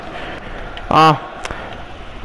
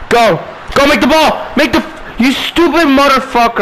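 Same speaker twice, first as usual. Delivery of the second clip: angry yell; 9 semitones higher than usual. Real, not acted. Yelling at game players.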